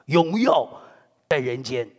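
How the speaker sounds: usual speaking voice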